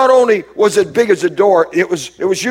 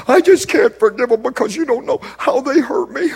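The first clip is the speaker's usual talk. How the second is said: higher, put-on whiny voice, lightly done; mock complaining churchgoer; 7 semitones higher than usual. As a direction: imitate a hurt churchgoer making excuses. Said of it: "what we have a lot of in the church world is say".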